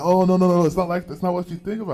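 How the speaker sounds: high, hurried mock protest; flustered denial. Customer caught out by the cashier, flustered denial. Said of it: "She's like".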